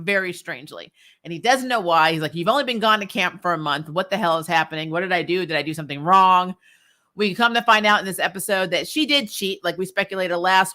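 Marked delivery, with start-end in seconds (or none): none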